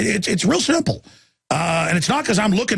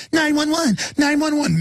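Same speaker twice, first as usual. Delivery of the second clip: much higher whiny mock panic; not parrot voice; 10 semitones higher than usual. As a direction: squeak it out helplessly, pitched way up. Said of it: "It was like".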